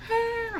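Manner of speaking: high, sustained mock cry; imitated child's wail. Daughter calling mom in tears, imitated wail. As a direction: mimic a kid bawling. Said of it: "she's calling me crying".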